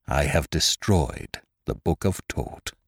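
slow, low, grave statement; grim. A narrator's reading. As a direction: say it heavily and gravely. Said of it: "replied the doctor grimly".

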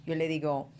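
ordinary talk, nothing marked

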